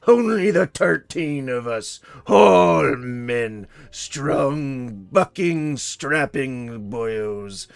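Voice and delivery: put-on slow, loud, hammy old sea captain; pirate voice. Pirate telling a sea tale, hammed-up slow pirate. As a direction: spin a yarn like a salty old pirate. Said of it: "he talks like a pirate".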